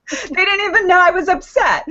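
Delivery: usual speaking voice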